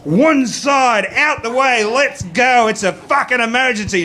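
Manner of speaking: loud, high bossy yell; urgent shouted order. Retold or imitated. Comic voicing his girlfriend shoving dudes aside, shouting urgently. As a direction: clear a path through a crowd.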